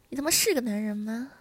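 loud, contemptuous challenge; angry scolding. Acted. Wife berating her husband, loud and angry. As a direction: throw the question out loudly in anger.

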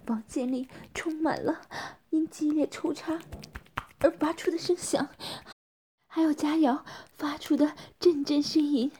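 usual speaking voice

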